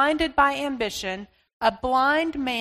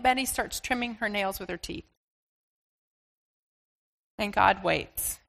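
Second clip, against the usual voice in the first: ordinary talk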